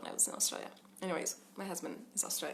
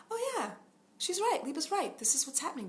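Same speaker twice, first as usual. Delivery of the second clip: much higher, light, calm self-talk; calm observing parent; 8 semitones higher than usual. Retold or imitated. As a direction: gently step back and notice. Parent calmly observing the child's emotions.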